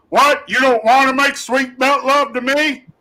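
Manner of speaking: hammed-up drill instructor tearing into a recruit; imitating a sergeant going off on a recruit; drill instructor yelling. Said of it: "And he goes".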